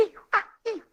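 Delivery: very high, squeaky imitation of joy; repeated high-pitched mock squeal; giddy outcry. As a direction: mimic an overjoyed woman squealing. Mimicking excited woman following him.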